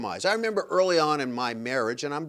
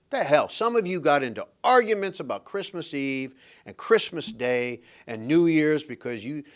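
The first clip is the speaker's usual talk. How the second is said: ordinary talk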